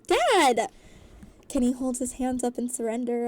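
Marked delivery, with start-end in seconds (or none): 0.1-0.7 loud, high cry of protest; mortified daughter's yell, high then falling; scream. A narrator's reading. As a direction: scream it at your father. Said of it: "Wyan screams".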